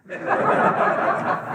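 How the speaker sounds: short breathy laugh at the punchline; a quick real laugh at own story. Real, not acted.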